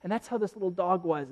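ordinary talk

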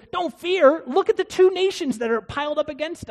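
high, loud alarmed retort; panicked king. Retold or imitated. King Ahaz answering the prophet, high and alarmed. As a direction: sound alarmed, pointing at the threat. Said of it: "he says".

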